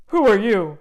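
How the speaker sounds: loud gruff question; troll demand. Acted. Troll questioning the middle goat, loud. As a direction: challenge the stranger.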